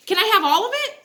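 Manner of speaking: high, cheeky ask; high cheeky mock plea; cheeky grab. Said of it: "I grabbed the wallet and I'm like".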